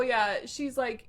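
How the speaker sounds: ordinary talk, nothing marked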